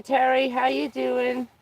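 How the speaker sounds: usual speaking voice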